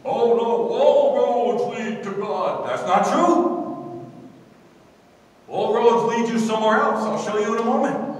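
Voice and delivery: loud, high declarations with stretched key words; ringing, insistent pulpit delivery; full-force preaching. Acted. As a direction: preach it out emphatically to the congregation.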